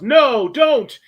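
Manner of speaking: high, loud desperate command; loud, high-pitched dramatic shout; acted yell. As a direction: yell it out as a frantic order to stop. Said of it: "He yells".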